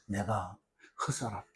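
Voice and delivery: very low, faint dying words; dying regret. Acted. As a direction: imitate someone's last regretful words, barely voiced. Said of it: "마지막 죽을 때 뭐라 합니까".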